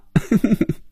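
high breathy giggle; teasing giggle. Acted. Phoebe laughing at her own tease, high giggle. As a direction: giggle cheekily.

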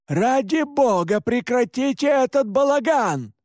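high-pitched, pleading shout; exasperated shout. A narrator's reading. Father Pascal stopping the commotion, shouting high. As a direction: shout for order over the chaos. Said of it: "крикнул отец Паскаль".

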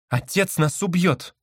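high, slow alarmed cry; frightened. A narrator's reading. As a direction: draw it out in fear. Sister panics.

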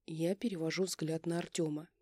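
usual speaking voice

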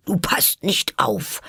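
stern, high-pitched scolding, slowed down; streng. A narrator's reading. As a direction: scold slowly, like a strict little teacher. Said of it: "sagte die Maus strenge zu Alice". (streng tadelnd)

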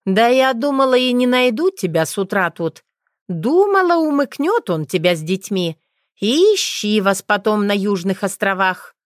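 a witch teasing her friend; brash witch. A narrator's reading. (дерзкая ведьма)

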